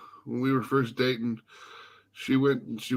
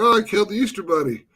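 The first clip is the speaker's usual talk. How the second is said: joking; 7 semitones higher, 4 dB louder, faster than usual. Retold or imitated. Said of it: "I was just joking around".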